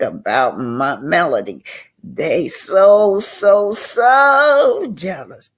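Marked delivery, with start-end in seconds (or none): 2.0-5.5 drawn-out, high, loud so jealous; mock jealous whine. Real, not acted. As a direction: stretch out jealous to tease them. Mocking jealous rivals.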